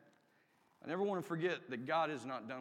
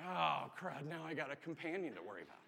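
mild mock dismay, kept under the breath; dismayed groaning thought; 4 dB quieter than usual. Patient stuck with a new companion, dismayed grumble.

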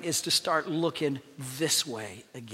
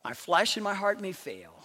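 normal talking voice

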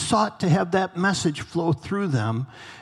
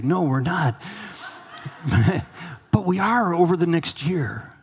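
ordinary talk

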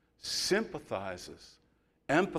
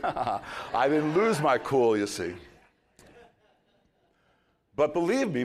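ordinary delivery

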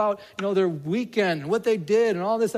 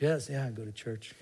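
flat apathetic yes; 5 semitones lower, 6 dB quieter than usual. Retold or imitated.

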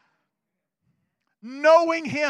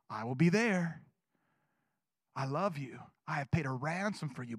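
tender promise; 10 semitones lower, 6 dB quieter than usual. Acted. Reading God's promise softly.

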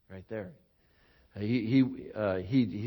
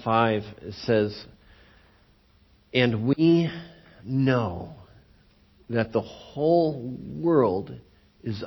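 prayer; 9 dB louder than usual. Real, not acted. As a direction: pray aloud over the congregation. Pastor leads closing prayer.

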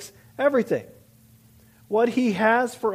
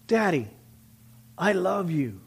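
quieter acted child's confession; tender; 2 semitones lower, 3 dB quieter than usual. Child tells father of love, acted tenderly. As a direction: say it gently, slowing down after the calls.

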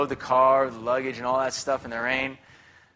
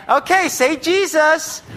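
much higher, slow sing-song mom voice; imitating mom; 12 semitones higher, 6 dB louder, slower than usual. Son mimicking his mom at photo time, high and slow. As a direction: put on mom's high chirpy prompt. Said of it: "you got your mom's out".

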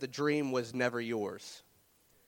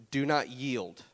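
ordinary talk

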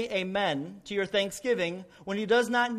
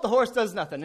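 usual speaking voice

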